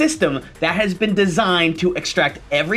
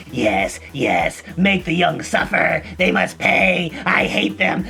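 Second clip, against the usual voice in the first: put-on cackling villainous old lady voice; scheming witchy grandma; evil grandma; slower than usual. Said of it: "The grandma ... isn't going".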